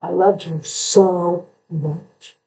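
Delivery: slow phrase, "so" held long; stress on SO. Shown as a demonstration. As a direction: stretch out "so" before the last word. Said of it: "putting the inflection on certain words".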